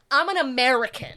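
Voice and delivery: loud, punchy American delivery; big, forceful declaration; brash American. Shown as a demonstration. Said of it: "you gotta be like".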